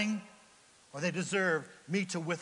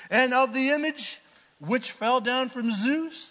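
loud, high proclamation to a crowd; raised, projecting orator's voice; declamatory scripture reading; 7 semitones higher, 7 dB louder than usual. Acted. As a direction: address the crowd as a city clerk. Reads the clerk's speech aloud.

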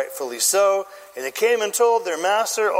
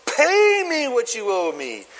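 drawn-out, higher-pitched demand; choking demand; 4 semitones higher, slower than usual. Acted. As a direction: demand payment with hands at his throat. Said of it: "By the throat".

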